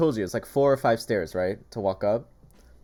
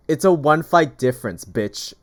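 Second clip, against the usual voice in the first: retold snap back; 4 semitones higher, 8 dB louder than usual. She snaps back at man.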